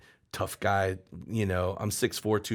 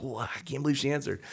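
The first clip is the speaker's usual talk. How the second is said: higher, hushed disbelief; retold amazement; 5 semitones higher, 4 dB quieter, faster than usual. Caller amazed his girlfriend picked up, retold surprise. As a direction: marvel quietly to yourself, pitch lifted.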